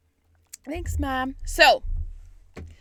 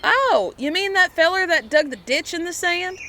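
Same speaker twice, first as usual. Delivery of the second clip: a cocky Yankee scoffing; brash American; 8 semitones higher, 5 dB louder than usual. Acted. American dismissing the French canal, folksy mocking.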